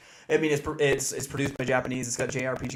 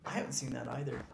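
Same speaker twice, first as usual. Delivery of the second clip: whispering; 9 dB quieter, faster than usual. Real, not acted. Whispered aside.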